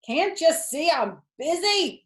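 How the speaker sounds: put-on high, loud annoyed boy; fed-up snap at mother; disgusted. A narrator's reading. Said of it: "replied Avery in disgust".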